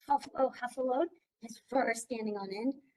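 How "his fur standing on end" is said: ordinary delivery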